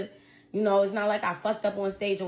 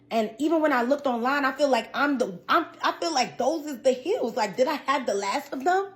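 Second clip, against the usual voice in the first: normal talking voice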